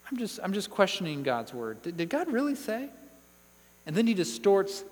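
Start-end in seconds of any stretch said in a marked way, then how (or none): 0.1-2.9 just asking, with a doubtful upward lilt; innocent questioning. Acted. Preacher voicing the serpent sowing doubt, innocently questioning. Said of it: "First, he just questions".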